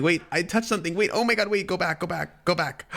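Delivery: high, hurried mild panic; flustered panic. Real, not acted. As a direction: fret as the screen slips away. Accidentally touched the phone screen.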